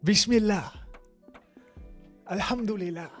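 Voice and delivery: ordinary talk, nothing marked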